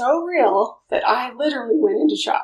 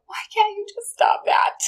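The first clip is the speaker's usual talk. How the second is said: exasperated mother; 9 semitones higher than usual. Retold or imitated. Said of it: "a child who I was like".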